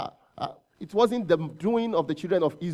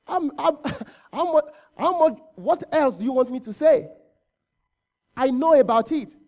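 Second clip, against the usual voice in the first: raised, higher-pitched exasperated reply; exasperated God; 5 semitones higher, 4 dB louder than usual. Acted. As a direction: protest as if asked once too often. Preacher voices God answering repeated pleas.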